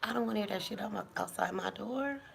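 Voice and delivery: normal talking voice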